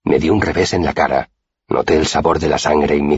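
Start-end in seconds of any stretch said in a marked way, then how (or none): none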